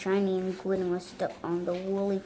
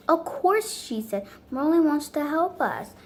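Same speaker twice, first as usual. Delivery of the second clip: delighted; 7 semitones higher, 5 dB louder than usual. Acted. Princess has happy idea.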